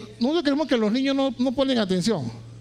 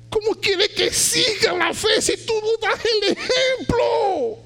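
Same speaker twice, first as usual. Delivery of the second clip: preaching at full force; 11 semitones higher, slower than usual. Acted. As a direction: rise to a fervent climax. Preaching climax. (predicación ferviente a gritos)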